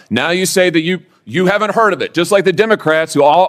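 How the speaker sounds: ordinary delivery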